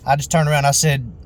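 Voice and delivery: usual speaking voice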